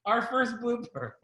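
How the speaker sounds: a laughing mock cheer; mock chat comment. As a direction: tease like a gleeful chatter. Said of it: "someone in the chat was like".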